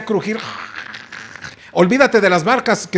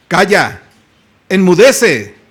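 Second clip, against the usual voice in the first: loud, firm command; commanding rebuke; 5 dB louder, slower than usual. Acted. Jesus rebuking the wind and the sea, loud command. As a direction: order the sea to be still.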